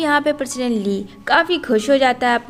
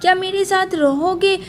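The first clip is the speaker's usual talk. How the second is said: put-on much higher, insistent child's questions; insistent asking; 7 semitones higher than usual. Boy asks mummy to live with him, insistent asking. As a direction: press for an answer, pitched high. Child presses woman to stay.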